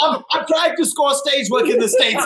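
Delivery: mock showbiz pitch to the room; laughing, playing the hopeful actor; a hint of an American actor; hammed-up actor voice. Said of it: "I've got a lot of accents".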